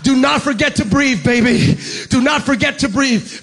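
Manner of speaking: loud preached refrain; preacher repeating the line with a baby tag; preaching refrain. Acted. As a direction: hammer the reminder home. Preaching the reminder.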